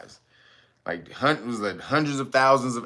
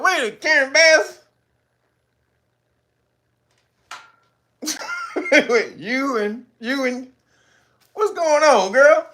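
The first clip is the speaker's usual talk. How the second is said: high, loud surprised squeal; hammed-up high surprised gossip; shocked gossip; 12 semitones higher, 4 dB louder, slower than usual. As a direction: gasp it out in high disbelief. Mock surprised onlooker.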